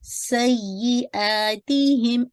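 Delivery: slow recited सय्यिआतिहिम; Quran recitation. Shown as a demonstration. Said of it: "इसको आपने थोड़ा सा देखना है".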